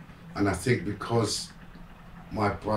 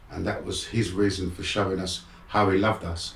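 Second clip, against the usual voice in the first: normal talking voice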